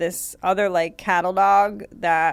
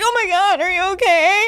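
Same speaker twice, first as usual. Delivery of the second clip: high, loud fussing over his dog; panicked dog owner; 12 semitones higher, 4 dB louder than usual. Retold or imitated. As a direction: gasp it out like a frantic owner. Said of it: "the guy's like".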